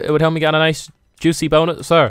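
ordinary talk

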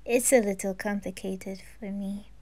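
quiet shy reply; shy whisper. Acted. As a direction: mumble it away, embarrassed. Depressed character opens up.

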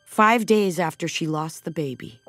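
plain reading voice

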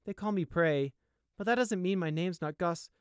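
put-on high, unsure young voice; a hesitant little brother; hesitant young deer.

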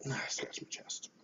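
very soft, unvoiced whisper; whispered aside. Real, not acted.

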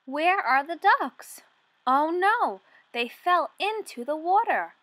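ordinary talk, nothing marked

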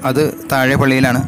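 ordinary delivery

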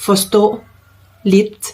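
slow model phrase with a pause after each word. Shown as a demonstration. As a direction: say each word separately for learners. (พูดช้าๆ ทีละคำ)

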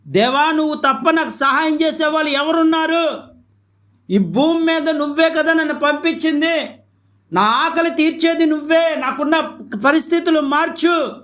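high, impassioned begging to God; pleading prayer. Acted. Preacher modelling a believer's desperate prayer to God, pleading. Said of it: "నువ్వు బ్రతిమలాడాలి".

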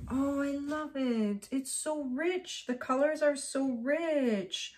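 high, soft, slow cooing; tender admiration. Real, not acted. Artist admires the rich colors of her painting, adoring. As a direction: savour it, drawn out and hushed.

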